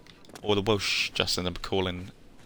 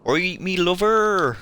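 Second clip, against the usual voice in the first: slow, higher Bristolian farmer's call; drawn-out Bristolian greeting, pitched up and loud; Bristolian accent; 10 semitones higher, 6 dB louder, slower than usual. Retold or imitated. Gamer quoting a Fable villager, hammy Bristolian accent. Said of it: "is, uh, a Bristolian one".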